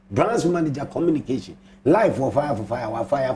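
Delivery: ordinary delivery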